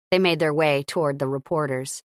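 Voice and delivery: plain reading voice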